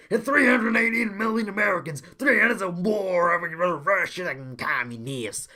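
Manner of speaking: fake-sob it out like a broken-hearted announcer; mock crying JBL rant. A reviewer mocking JBL on commentary with hammed-up crying. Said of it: "J B L to start crying and going".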